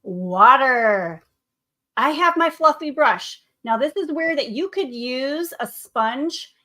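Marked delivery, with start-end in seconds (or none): 0.0-1.2 water louder and falling at the end; stress on water. Shown as a demonstration. Teacher naming the key word to students, stressing water. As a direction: land hard on the word water. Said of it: "the important word in watercolors is".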